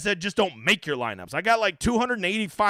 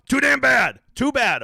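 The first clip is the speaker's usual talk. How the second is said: mock-gruff teasing shout; 7 semitones higher, 6 dB louder, slower than usual. Teasing chat about drawing.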